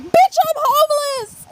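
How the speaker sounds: high-pitched retold outburst; indignant retort. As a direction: fire back at a pesky caller. Retort to bill collector.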